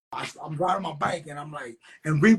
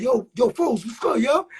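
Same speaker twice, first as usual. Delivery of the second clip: high, rising phone hello; acted eager greeting; 6 semitones higher, 4 dB louder than usual. Man greeting his friend on the phone, acted eagerness. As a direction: imitate him hailing an old friend. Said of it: "it, it just changed".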